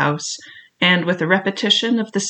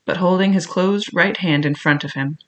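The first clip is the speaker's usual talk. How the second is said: usual speaking voice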